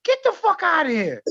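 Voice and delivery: giddy high yell of disbelief; excited disbelief. Retold or imitated. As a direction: squeal like a starstruck fan. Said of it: "I was like".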